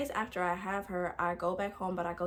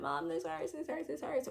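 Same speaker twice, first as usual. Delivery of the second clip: high, soft mock whine of pain; retold pained whine; 6 semitones higher, 4 dB quieter than usual. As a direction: whimper it like you're replaying labor pain. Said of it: "I'm in pain".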